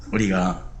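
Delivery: normal talking voice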